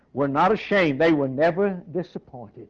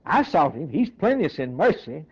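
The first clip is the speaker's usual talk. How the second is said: ordinary talk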